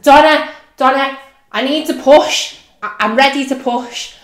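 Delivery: loud high desperate plea to the midwife; frantic labouring woman; urgent pleading. Retold or imitated. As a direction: beg her to let you push, loudly. Begging midwife during labour.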